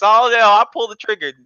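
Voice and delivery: ordinary talk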